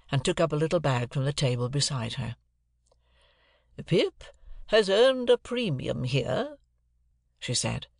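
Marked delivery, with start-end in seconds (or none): 3.9-6.6 high, slow, imperious lady. A narrator's reading. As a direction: pronounce it slowly from on high. Miss Havisham's dialogue in narration.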